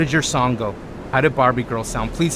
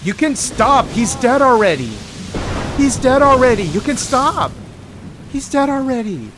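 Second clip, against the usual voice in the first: high yelling; 11 semitones higher, 3 dB louder than usual. Real, not acted. Yelling at dog attack.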